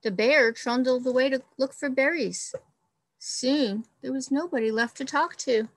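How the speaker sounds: expressive storybook read-aloud; reading to children. Acted. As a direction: read it like a picture book for the class. Teacher reads picture book aloud.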